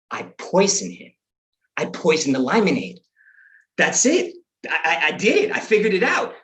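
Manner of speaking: building mock triumph; gleeful scheming discovery; excited realization. Acts out his eureka moment.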